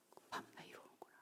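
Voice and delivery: faint breathy whisper of a shaken realization; "급하면 나 이러는구나" 거의 들리지 않게 속삭이듯. Retold or imitated. Inner realization quoted as whisper. (깨달음에 놀라 혼잣말하듯 속삭임)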